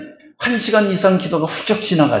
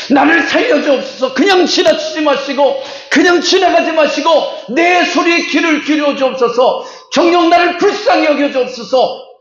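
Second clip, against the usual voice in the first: blind men crying out; 9 semitones higher than usual. Acted. Pastor voicing the blind men pleading to Jesus, desperate cry. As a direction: beg Jesus not to pass by. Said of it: "뭐 하는 거예요?".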